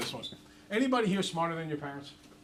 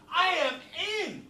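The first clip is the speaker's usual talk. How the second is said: eager; 13 semitones higher, 5 dB louder than usual. Retold or imitated. Kid accepting the deal.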